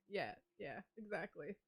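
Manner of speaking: ordinary talk, nothing marked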